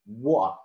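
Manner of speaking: imitated British "wa" with t and r dropped; "wa" for "water", British style; British pronunciation. Shown as a demonstration. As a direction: swallow the end of "water" into "wa". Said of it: "the British people, they say like".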